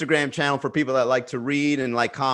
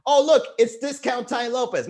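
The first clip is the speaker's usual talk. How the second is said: high, louder teasing jeer; mocking commenter; 10 semitones higher, 4 dB louder than usual. Commenters mock his look, high teasing jeer. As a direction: sneer it like a heckler spotting a knockoff. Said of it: "people are like".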